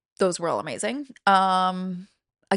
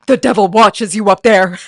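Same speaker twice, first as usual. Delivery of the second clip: loud, hammed-up Southern warning; Southern-accented warning at full volume; 11 dB louder than usual. Auditioner warning the next kid, loud Southern accent. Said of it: "and I go".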